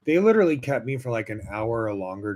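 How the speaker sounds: ordinary talk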